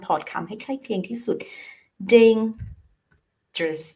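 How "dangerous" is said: "dangerous" as "dayng", long pause, "jrus"; slow teaching "dayng... jrus" for "dangerous"; broken in two. Shown as a demonstration. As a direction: split "dangerous" slowly into two separate halves. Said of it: "แต่เอาบีบเข้าไปอีกให้มันสั้น".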